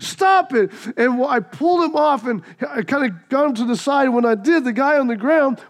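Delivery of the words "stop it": stop it. Retold or imitated. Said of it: "I said".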